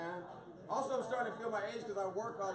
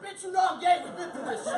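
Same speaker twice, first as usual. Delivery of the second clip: high, loud, sassy comeback; sassy retort; 11 semitones higher, 10 dB louder than usual. Retold or imitated. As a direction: snap back at the aunt with attitude. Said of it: "Yeah, like".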